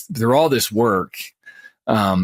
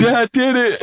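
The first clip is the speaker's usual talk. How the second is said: put-on high, louder excited kid shout; 13 semitones higher, 3 dB louder, slower than usual. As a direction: cry it out like a triumphant kid. Kids excitedly catch the trigger.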